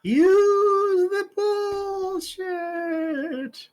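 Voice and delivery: high, long-drawn eerie wail; ghostly far-off voice. Acted. A voice from nowhere prompting the thinker, drawn out and high. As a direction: stretch every word out, high and echoing. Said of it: "Whose voice was that?".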